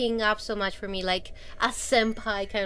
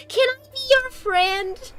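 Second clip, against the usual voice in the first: put-on very high, pleading little-girl voice; cute young girl; 12 semitones higher, 3 dB louder than usual. Girl asks the streamer to be friends, cute and high. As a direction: ask sweetly, high up, as a kid. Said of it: "she was so fucking cute because".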